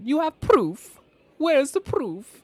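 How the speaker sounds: high, slow gloating villain. Retold or imitated. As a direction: drag it out like a smug villain. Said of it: "The way she said that was like a, a villain".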